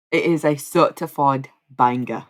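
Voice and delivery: mock British accent on certified banger. Shown as a demonstration. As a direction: say it again with a London twang. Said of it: "more British".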